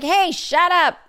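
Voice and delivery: high mock yell at the neighbor. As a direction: snap at the noisy neighbor. Yelling at noisy neighbor.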